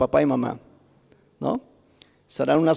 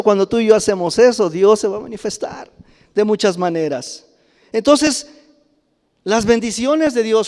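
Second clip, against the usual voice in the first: higher, louder, fervent proclamation of God's faithfulness; full-force preaching; 5 semitones higher, 4 dB louder than usual. Real, not acted. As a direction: preach it out to the congregation with rising fire.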